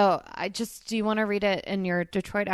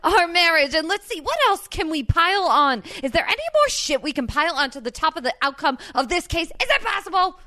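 worked-up rant, pitched up and building; exasperated Vinny; 6 semitones higher, 6 dB louder than usual. Acted. Vinny ranting at fiancée about his pressures, exasperated. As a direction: pile up grievances, hammering each item louder.

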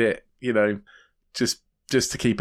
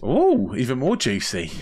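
even more thrilled, milking it; titillated; 3 semitones higher than usual. Real, not acted. Reacting to six bits of news.

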